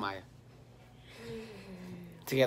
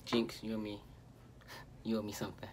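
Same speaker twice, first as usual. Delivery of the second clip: whispering; 6 semitones lower, 11 dB quieter than usual. Real, not acted. Teasing after jinx.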